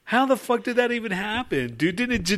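high, disbelieving "how the fuck"; can't believe what just happened; stunned disbelief. Real, not acted.

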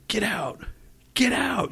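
thin, strained pretend scream; retold scream. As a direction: imitate yelling it from the top of your lungs. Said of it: "I started screaming".

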